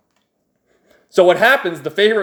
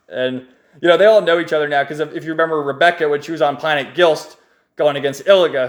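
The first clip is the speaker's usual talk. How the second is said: ordinary talk, nothing marked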